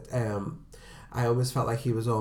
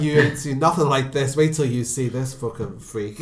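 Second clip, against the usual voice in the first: louder, lightly voiced brash porter; imitating the porter; 3 semitones higher, 7 dB louder than usual. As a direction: show off the patient like a carnival barker. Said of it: "He's saying, like".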